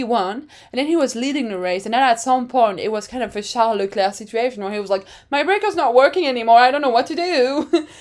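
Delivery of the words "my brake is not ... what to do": fast, higher, louder radio plea; mock panicked driver. Driver telling team his brake failed, mock panic. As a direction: imitate a flustered driver, rushing it out.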